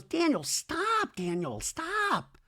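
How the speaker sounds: whiny mock pleading, slow and high; exasperated plea. Wife tells Daniel to stop, exasperated plea.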